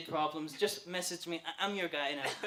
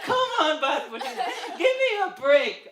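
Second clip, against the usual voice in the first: loud, high-pitched comic protest; mock exasperated pleading; 8 semitones higher, 6 dB louder than usual. Broke customer begging the shoe shop, loud mock exasperation.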